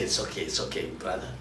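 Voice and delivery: hushed, calming a rival brother; soft soothing whisper. Retold or imitated. Whispering to the rival who refused his hug, calm and gentle. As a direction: breathe it out gently, smoothing things over. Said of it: "But I think I smiled".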